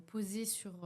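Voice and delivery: ordinary talk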